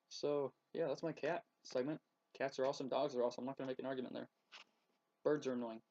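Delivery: ordinary talk, nothing marked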